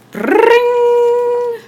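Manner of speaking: high, loud held bell ring; alarm bell ring. A narrator's reading. Reading a picture book, imitating the firehouse alarm bell.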